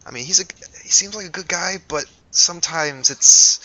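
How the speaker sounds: hesitant Eliza. Acted. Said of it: "she says".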